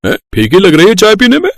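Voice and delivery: put-on high, fussy old man's grumble; an old man whining that the tea is unsweetened; complaining father-in-law. (फीकी चाय पर शिकायत करते ससुर की ऊँची, चिड़चिड़ी आवाज़)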